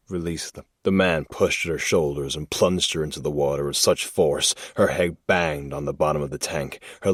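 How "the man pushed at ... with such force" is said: ordinary delivery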